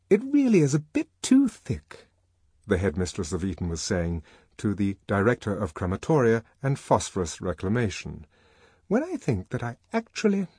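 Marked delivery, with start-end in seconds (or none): 0.1-2.1 high-pitched scandalised headmistress; high, haughty character voice; indignant headmistress. A narrator's reading. 8.9-10.5 high-pitched affronted character voice; high scandalised complaint; indignant headmistress. A narrator's reading.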